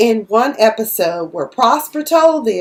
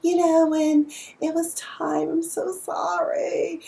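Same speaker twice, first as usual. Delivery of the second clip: apologetic Darla; 7 semitones higher, 5 dB quieter than usual. Retold or imitated. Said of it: "She was like".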